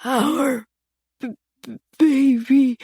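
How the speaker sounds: weak broken stutter. A narrator's reading. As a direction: struggle out each sound, dying. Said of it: "he stuttered".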